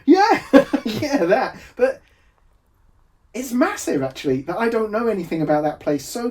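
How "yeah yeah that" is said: mimicking his own breezy laughing yeah, pitched up and louder; imitating his own glib brush-off, laughing. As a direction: toss it off with a laugh, mocking yourself. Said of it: "I like how I just go".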